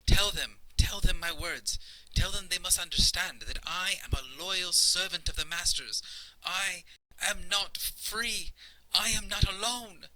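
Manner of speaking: very high, emphatic servant voice; afraid, insistent servant. Acted. Frightened servant begs companion to translate his loyalty, afraid and insistent. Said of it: "he's getting more and more uncomfortable and more and more afraid".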